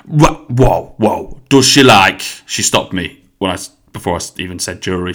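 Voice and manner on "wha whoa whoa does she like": loud, slow Nottingham interruption; Nottingham hairdresser. Retold or imitated. Hairdresser stopping customer mid-answer, loud mock Nottingham accent. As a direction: halt someone mid-sentence, hammed-up Nottingham. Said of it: "And she was like".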